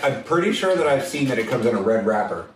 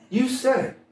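teasing; 12 semitones higher than usual. Real, not acted. Teasing co-host for slip.

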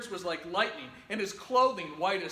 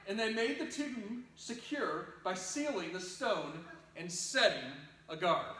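usual speaking voice

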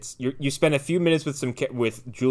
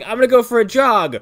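a touch higher and louder, breezy daughter; mock cheerful girl; 8 semitones higher, 5 dB louder than usual. Imitating the daughter heading out after a bad night, mock cheerful. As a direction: announce it like a chirpy teen. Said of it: "she's like".

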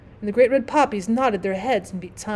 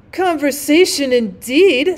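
scornful retort; 4 semitones higher, 6 dB louder, slower than usual. A narrator's reading. Rocket scornful.

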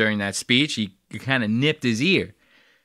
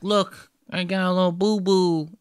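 put-on higher childish voice; childlike; 6 semitones higher than usual. Vlogger mimics Trump as a child showing a boo-boo, higher childish voice. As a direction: play a small kid showing his scrape. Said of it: "He's like a child".

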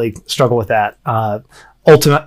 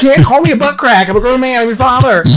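higher, half-laughing fake outrage; mock indignant dad; 9 semitones higher than usual. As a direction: play the offended father, barely holding back a laugh. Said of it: "I'm looking at her like".

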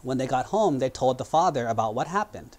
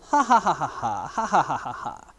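goat laughing; 3 semitones higher, 4 dB louder than usual. A narrator's reading. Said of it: "the goat started laughing".

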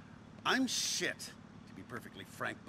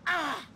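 high, loud anguished wail; wounded shout of despair; anguished cry; 9 semitones higher, 7 dB louder than usual. Acted. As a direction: let out a cry of pain. Anguished outburst over wife.

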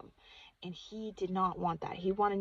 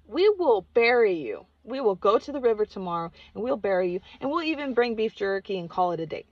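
louder mock-grave joking threat; 3 semitones higher, 9 dB louder than usual. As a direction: tease the spirit with a deadpan threat. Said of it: "he even made a joke about".

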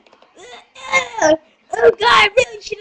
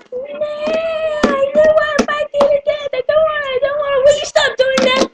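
high, fast whine; whiny refusal; 11 semitones higher, much faster than usual. Acted. Refusing to fight to the death, high whiny protest.

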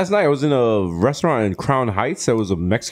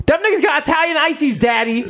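mock kid excitement; 13 semitones higher than usual. Retold kid's amazement.

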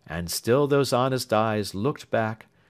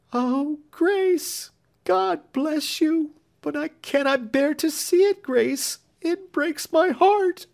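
much higher, slow tearful cry; sobbing; 4 dB louder, slower than usual. A narrator's reading. Younger sister sobs to Grace she cannot bear it. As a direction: pour out grief on her sister's neck. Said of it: "fell sobbing on her neck".

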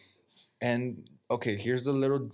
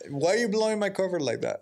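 fast, higher playful protest; mock betrayal; 8 semitones higher, faster than usual. Friend exposes him watching UFC, fast mock protest. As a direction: cry foul in fake alarm.

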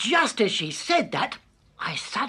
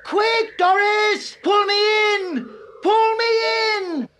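much higher urgent shout; loud call; 10 semitones higher, 4 dB louder, slower than usual. A narrator's reading. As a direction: yell down for help. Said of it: "I called down as loudly as I could".

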